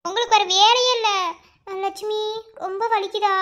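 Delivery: normal talking voice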